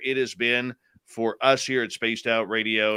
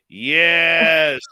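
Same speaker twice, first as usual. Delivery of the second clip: excited; 4 semitones higher, 8 dB louder than usual. Real, not acted. Celebrates on seeing a comment.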